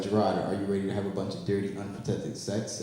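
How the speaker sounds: low, soft inviting voice; sultry proposition. Acted. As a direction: tempt him softly. Prostitute voiced in bit.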